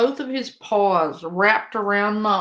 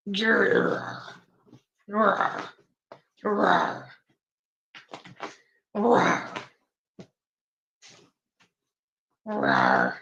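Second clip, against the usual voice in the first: playful growl; 4 semitones lower, much slower than usual. Pretend. Playing rough with her cat.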